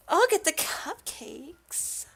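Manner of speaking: high, drawn-out character voice; slow, high-pitched offer; game girl character. Acted. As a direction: stretch it out in a high girlish voice. Voicing a game girl's offer.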